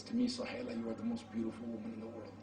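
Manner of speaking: very soft, low and quick; tender reassurance. Retold or imitated. Husband reassuring his wife she is beautiful, tender and hushed. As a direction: murmur it lovingly, almost under the breath.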